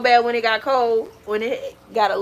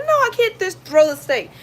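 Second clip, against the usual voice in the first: imitating Darnell; 9 semitones higher, 3 dB louder than usual. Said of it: "he's like".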